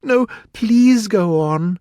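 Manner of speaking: high, slow, earnest plea; very humble. A narrator's reading. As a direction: humbly urge them to continue. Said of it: "Alice said very humbly".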